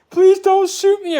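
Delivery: imitating the cop begging for his life, high and pleading; mock pleading. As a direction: beg in a high scared voice.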